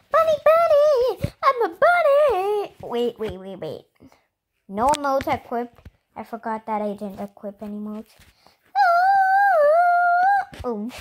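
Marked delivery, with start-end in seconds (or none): 0.1-2.7 very high, loud bunny squeal; high-pitched, over-the-top excitement; excited squeal. Real, not acted. 8.7-10.6 long, high, loud scream; high scream of surprise; startled scream. Real, not acted. Startled by being suddenly teleported.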